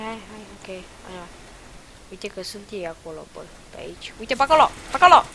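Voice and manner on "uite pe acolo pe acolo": rising loud urgent call; urgent callout. Real, not acted. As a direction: point them out fast. Calling out enemy location.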